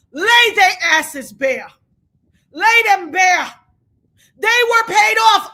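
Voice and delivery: slow, loud, hammering each word; furious demand. Real, not acted. Streamer urging viewers to expose the jurors, slow furious shout. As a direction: pound out the demand in real rage. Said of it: "I'm so angry".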